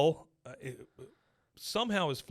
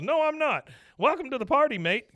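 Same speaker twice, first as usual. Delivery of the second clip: much higher, louder puppet voice; squeaky puppet voice; 12 semitones higher, 7 dB louder than usual. Retold or imitated. Penguin puppet answering the donkey, high squeaky voice. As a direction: pipe up like a silly puppet.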